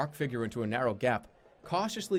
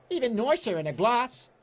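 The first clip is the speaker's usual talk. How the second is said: a higher, louder put-on barmaid; bar woman voice; 11 semitones higher, 5 dB louder than usual. Goblin barmaid selling beer to the player, pitched up and loud. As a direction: play a cheerful goblin barmaid. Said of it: "that was awful".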